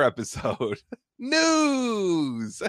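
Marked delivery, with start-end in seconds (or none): 1.2-2.6 high sung-out news jingle; news jingle shout. Pretend. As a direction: belt it like a jingle. Announces a news segment jingle-style.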